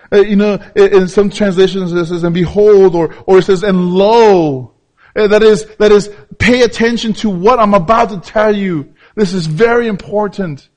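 loud, high call for attention; loud preaching. Acted. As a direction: grab the congregation's attention.

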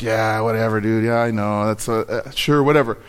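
low, bored brush-off; dismissive. Retold or imitated. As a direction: shrug off the teacher's advice. Retold brushing off the teacher.

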